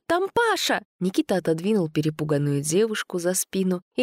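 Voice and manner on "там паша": high panicked cry; panicked shriek. A narrator's reading. Sveta panicking after a shriek.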